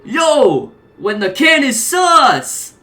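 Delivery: high, loud, slow hyped yell; Ken yelling. Acted. Ken bursting from the trash can, yelling. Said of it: "burst out of it and yell".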